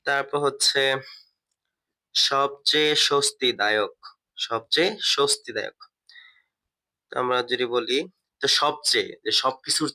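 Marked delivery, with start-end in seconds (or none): 2.1-5.8 slow dictation. Shown as a demonstration. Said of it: "teacher repeats the phrase".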